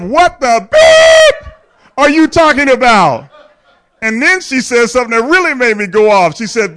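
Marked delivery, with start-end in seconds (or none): 0.0-3.3 high, loud and slow retold outburst; mock cursing yell. Dad yelling at wife who skipped his supper, mock cursing yell. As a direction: yell the bleeped curse in fun. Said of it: "I start cursing".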